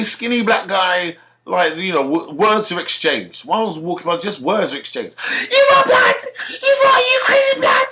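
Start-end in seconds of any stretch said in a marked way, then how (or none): 5.5-7.9 shrill high falsetto screaming, blood; hammed-up shriek, way up high and loud; high falsetto road-man scream; shrieking roadman. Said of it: "screaming like he's a woman".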